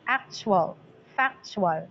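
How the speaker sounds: "Actual, factual" as AC-tual, FAC-tual, falling away; stressed then unstressed. Shown as a demonstration. Teacher sounding out feminine rhyme, stress then unstress. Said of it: "Stress, unstress".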